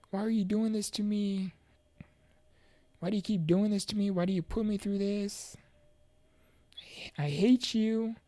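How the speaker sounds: retold anguished accusation, voice lifted; acted inner lashing out. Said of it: "negative thoughts were telling me".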